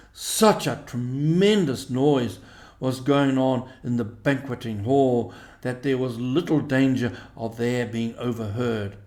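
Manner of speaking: ordinary delivery, nothing marked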